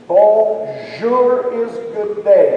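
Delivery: slow, deliberate definition; slow teaching. Shown as a demonstration. Performer teaching the crowd a word, slow and deliberate. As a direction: spell out the meaning slowly. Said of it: "teacher defining a word".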